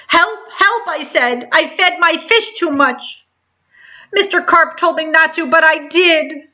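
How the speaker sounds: put-on frantic boy on the phone; panicked child. A narrator's reading. Boy calls police in panic.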